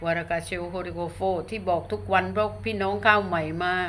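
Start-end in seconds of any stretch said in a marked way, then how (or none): 0.0-1.2 brief burst of spirit-language syllables; speaking in tongues. Real, not acted. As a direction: slip into an unknown tongue. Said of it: "บางคนยังไม่รู้เรื่องว่าภาษาอะไร".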